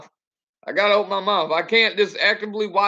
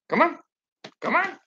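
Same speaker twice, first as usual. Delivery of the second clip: high coaxing call to dog; 8 semitones higher, 3 dB quieter than usual. Real, not acted. Calling the dog over.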